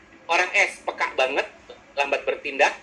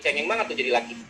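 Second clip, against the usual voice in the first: ordinary delivery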